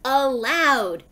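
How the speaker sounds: drawn-out, scolding call; mild shout. A narrator's reading. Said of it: "Eugenia shouted".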